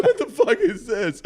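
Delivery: high, slow, laughing retort; amused disbelief. Retold or imitated. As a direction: laugh through the baffled question. Laughing at son's impression video.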